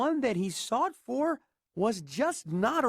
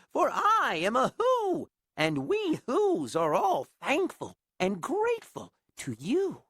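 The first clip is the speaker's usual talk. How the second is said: high small voice calling up; a little Who calling up proudly; tiny mayor calling; 7 semitones higher than usual. Acted. Mayor introducing Whoville to Horton, high and small. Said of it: "called the voice".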